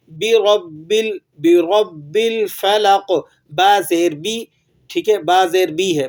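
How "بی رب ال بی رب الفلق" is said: بی رب الفلق spelled out very slowly; slow recitation. Shown as a demonstration. Teacher leads students to repeat along. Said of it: "میرے ساتھ ساتھ دہرا لیجئے".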